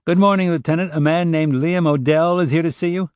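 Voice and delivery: high, loud voice through a tinny office intercom; squawking intercom. Acted. Receptionist announcing a visitor over the intercom, squawky. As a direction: squawk it out like a desk speaker. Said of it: "the intercom squawked".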